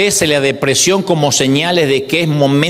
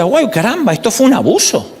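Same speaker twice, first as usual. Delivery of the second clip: a raised gasp of realizing; surprised realization; 6 semitones higher than usual. Retold or imitated. Preacher voicing a listener who suddenly sees the abuse, surprised. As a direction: voice lifts as it dawns on you.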